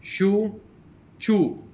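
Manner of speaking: loud, separated words, rising then falling in pairs; teacher's model pronunciation, each word on its own; word-list reading. Shown as a demonstration. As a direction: read out minimal pairs clearly for a class. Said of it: "going to read these, the words".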